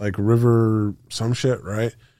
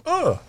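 pained grunt. Retold or imitated. Getting shot down.

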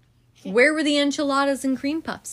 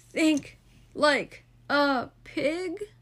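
slow dazed murmur; 5 semitones higher, much slower than usual. A narrator's reading. Francine dazed after fall.

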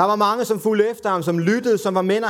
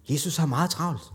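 ordinary talk, nothing marked

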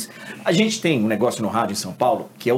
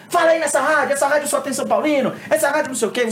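mock ranting fan; 13 semitones higher, faster than usual. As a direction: rail at the radio station.

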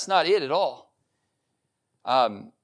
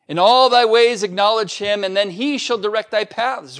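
louder, higher solemn scripture reading; 5 semitones higher, 6 dB louder than usual. Acted. Pastor reading Proverbs to the congregation, solemn and louder. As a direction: proclaim the verse to the church. Rereads the verse.

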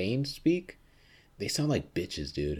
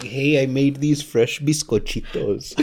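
slow, louder mock New Mexican lilt; New Mexican accent; 3 semitones higher, 7 dB louder, slower than usual. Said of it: "New Mexican Spanish".

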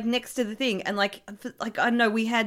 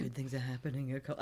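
eerie hushed echo; 7 semitones lower, 13 dB quieter, faster than usual. Pretend. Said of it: "echoed creepy line".

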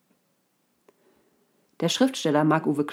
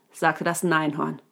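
usual speaking voice

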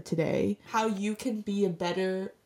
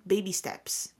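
usual speaking voice

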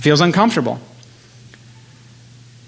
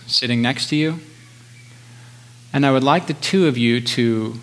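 soft spa whisper; 2 semitones lower, 4 dB quieter than usual. Acted. Leads a guided meditation.

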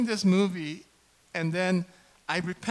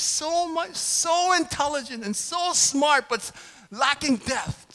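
high, loud and slow, every word stretched; frustrated rant; 11 semitones higher, 7 dB louder, slower than usual. Real, not acted. Pastor ranting about a smart but shallow generation, high and slow. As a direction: vent in disbelief.

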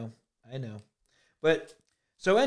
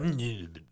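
lower, softer muffled mm sounds; muffled hums; 6 semitones lower, 7 dB quieter than usual. Retold or imitated. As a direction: hum through a closed mouth as if gagged. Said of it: "it was, like, muffled".

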